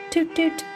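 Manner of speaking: high playful horn noise; toot toot. Acted. Little Miss Naughty having fun on a bus, tooting.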